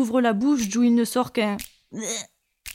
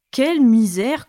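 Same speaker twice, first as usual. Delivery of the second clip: loud, lightly voiced crow; hint of a croak, disdainful and loud; 3 semitones higher, 8 dB louder than usual. A narrator's reading. Crow eyeing the four travelers, croaking. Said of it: "croasse-t-il".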